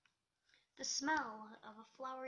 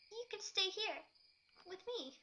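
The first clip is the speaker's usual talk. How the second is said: a lightly voiced, hushed Pinkie holding on; Pinkie Pie's voice; 8 semitones higher, 3 dB louder than usual. A narrator's reading. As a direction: Pinkie Pie pleads with Twilight to stay. Pinkie clings to her friend.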